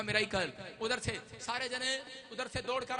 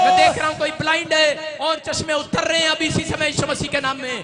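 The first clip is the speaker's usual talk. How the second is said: shouted prophetic declarations; loud faith-healer proclaiming; preaching at full force; 3 semitones higher, 16 dB louder than usual. Acted.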